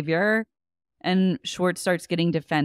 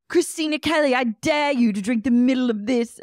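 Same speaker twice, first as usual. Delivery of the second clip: high, raised-voice imitation of a challenge; mock-dramatic challenge, high and projected; hammed-up dare; 6 semitones higher than usual. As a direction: throw down the dare in a pitched-up voice.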